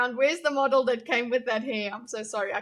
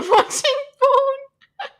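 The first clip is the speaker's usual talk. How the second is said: laughing through words; 14 semitones higher, 11 dB louder, much slower than usual. Real, not acted. Laughs while reading a joke.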